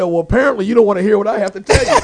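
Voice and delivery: fast and loud, laughing through it; laughing retort. Retold or imitated. Connie teasing his friend on the phone, laughing fast. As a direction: tease him, laughing.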